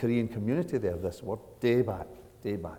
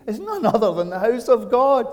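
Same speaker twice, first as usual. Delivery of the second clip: high, loud proclamation; exclaiming awe; 12 semitones higher, 7 dB louder than usual. Retold or imitated. Preacher quoting Jacob's discovery to congregation, exclaiming awe. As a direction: proclaim it with raised-pitch wonder.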